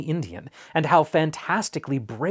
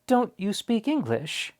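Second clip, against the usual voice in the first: put-on higher, slow, puzzled boy's question; boy asking; 8 semitones higher than usual. A narrator's reading. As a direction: ask slowly, a bit dismayed. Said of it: "asked Omri".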